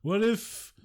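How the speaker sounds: high, slow mock-pondering voice; mocking a man sharing a druggy big thought; Elon Musk. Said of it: "And he was like".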